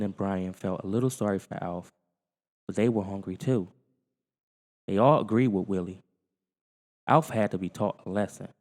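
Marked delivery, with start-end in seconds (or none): none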